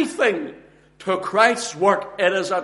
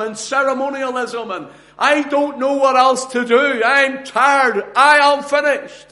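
fed-up outburst; 4 semitones higher than usual. Acted. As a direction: act out someone at the end of their rope, climbing to a cry. Acted weary outburst, building to breaking point.

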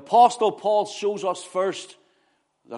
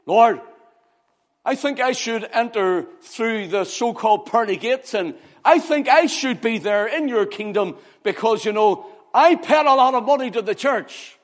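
high, loud, boastful plea; self-justifying; 6 semitones higher, 5 dB louder than usual. Acted. Churchgoer claims a place in heaven, self-justifying plea. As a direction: argue your own merit insistently. Imagined sinner boasting to God.

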